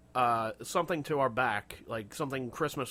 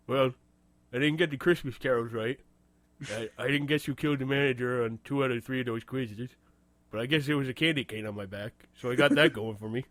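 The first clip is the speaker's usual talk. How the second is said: Carl from Caddyshack; 2 semitones lower than usual. Retold or imitated. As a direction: lightly put on a dim-witted caddy voice. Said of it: "It'd probably be more like".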